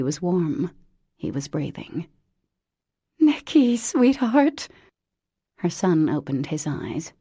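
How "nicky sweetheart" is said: tender. Acted. Mother finds son.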